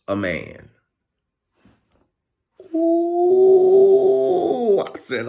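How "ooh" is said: high, loud delighted ooh; a long squeal of approval. Real, not acted. Reacting to a clever answer with a high loud ooh.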